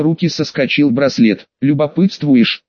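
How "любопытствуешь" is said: ordinary delivery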